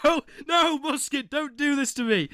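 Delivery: high, loud panicked shout of no; mock outrage. Streamer shouting at viewer Musket not to clip him, mock panic. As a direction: yell in hammed-up protest.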